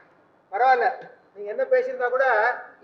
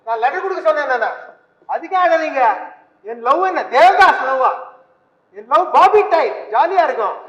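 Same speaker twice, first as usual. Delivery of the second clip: louder and louder, indignant boast about his love; teen boy loudly bragging his love is fun, not tragic; loud protest; 9 dB louder than usual. Acted. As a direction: shout it out, cocky and offended.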